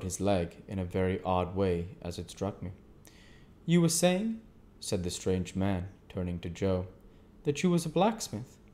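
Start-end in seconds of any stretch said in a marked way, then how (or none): 3.6-4.4 higher, louder stranger's voice. A narrator's reading. The stranger questions Joe. 7.4-8.6 the stranger. A narrator's reading. The stranger questions Joe.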